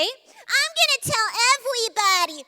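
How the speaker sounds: put-on high, loud childish threat; tattling amygdala. The amygdala threatening to tell everybody, high and childish. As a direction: tattle like a scared kid.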